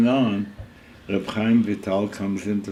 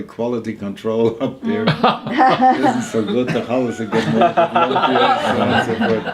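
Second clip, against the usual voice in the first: can barely get the words out for laughing; 3 semitones higher, 7 dB louder than usual. Real, not acted. Narrator laughing while voicing the shamus's prayer.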